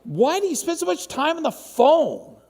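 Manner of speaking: put-on high, loud plea of a hurt child; whiny child complaint. Child begging parent to put the phone down, high and loud.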